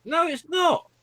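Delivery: high-pitched squeal of protest; mock-indignant denial. Real, not acted. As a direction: squeak the contradiction back in playful outrage. Playful back-and-forth quarrel.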